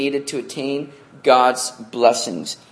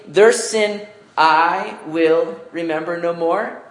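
each "I will" slightly raised and pressed; stressing I will; 3 semitones higher than usual. Shown as a demonstration. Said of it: "Notice he says".

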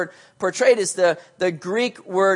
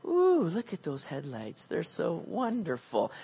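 dreamy hypnotized; 4 semitones lower, 10 dB quieter, slower than usual. Pretend. Deer hypnotized by headlights.

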